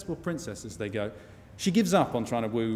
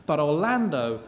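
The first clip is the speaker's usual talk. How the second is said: plain reading voice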